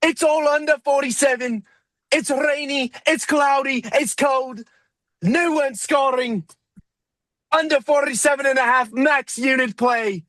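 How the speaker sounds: a pub-shouting Irishman with a hammed-up accent; shouted Irish accent. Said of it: "you just need to talk like that".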